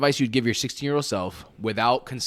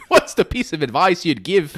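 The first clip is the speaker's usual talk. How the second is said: put-on high, loud British accent through laughter; mock British accent; 8 semitones higher, 10 dB louder than usual. Asking a guest for advice, laughing mock British accent. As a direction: put on a silly British interviewer, cracking up. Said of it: "do it in British accent".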